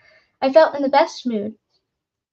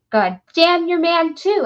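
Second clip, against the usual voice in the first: higher, louder furious shout; angry curse; 6 semitones higher, 5 dB louder than usual. Acted. Frightened woodcutter shouts curses at the visitor. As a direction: spit the curse back at him.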